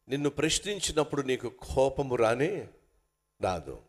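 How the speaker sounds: usual speaking voice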